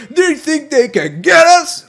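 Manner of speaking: high, loud, drawn-out self-mockery; exaggerated mock-cocky quote; mock bravado. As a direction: crow it slowly, loud and high, mocking yourself. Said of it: "I was like".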